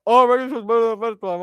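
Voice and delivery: high, fast, loud mocking babble; mocking fan quote. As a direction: rattle it off in a high parody voice. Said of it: "everyone's like".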